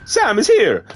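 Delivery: high, loud character voice for Dead Man. Acted. Dead Man's quoted line, character voice.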